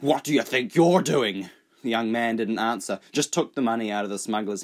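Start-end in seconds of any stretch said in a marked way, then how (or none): 0.0-1.5 louder, higher indignant challenge; puffed-up outrage at being grabbed; indignant snap. Acted. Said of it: "The smuggler snorted and puffed".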